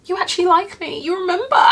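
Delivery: high, laughing mock amazement; touched disbelief. Telling fans who remember her, high touched disbelief. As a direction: squeal it like you can't believe they care. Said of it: "And I'm like".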